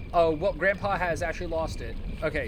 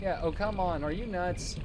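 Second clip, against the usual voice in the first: normal talking voice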